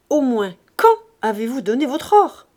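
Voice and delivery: loud, sharply stressed question; interrogate, punching the question word; forceful, heated questioning; exasperated demand. A narrator's reading. Father interrogates his daughter.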